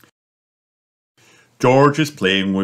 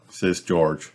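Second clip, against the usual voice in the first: plain reading voice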